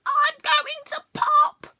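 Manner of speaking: terrified creature; panicked whimper. Acted. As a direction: whimper in terror. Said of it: "she cried".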